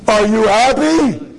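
slow, drawn-out accusing question; acted sarcastic confrontation; angry challenge. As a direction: demand an answer, stretching the words. Woman confronts rabbi in acted quarrel.

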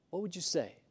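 soft echo of the question; hushed repeat. Shown as a demonstration. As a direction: let it sink in quietly. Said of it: "repeat softer".

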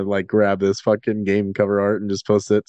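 usual speaking voice

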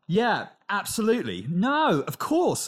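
lightly put-on corporate politeness; job interview voice. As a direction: agree eagerly like a job candidate. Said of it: "You speak in all these ways that you don't".

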